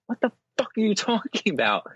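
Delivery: high-pitched incredulous outburst, breaking into laughter; exasperated, high and amused; acted-out bafflement. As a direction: act out total disbelief, half laughing. Retelling his baffled outburst.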